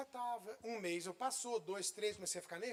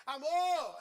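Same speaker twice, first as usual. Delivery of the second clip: loud call across the house; a loud retold call to his wife; 6 semitones higher, 9 dB louder than usual. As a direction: shout for the wife from the office. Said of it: "Eu dei um grito assim".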